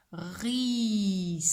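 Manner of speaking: "Ris" with long r and long final s; sounding out. Shown as a demonstration. Teacher dictates three sounds to pupil, "Ris" stretched slowly. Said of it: "Qui a trois sons".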